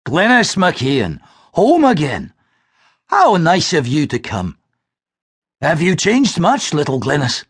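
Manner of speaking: put-on an old friend greeting her warmly; hearty old man.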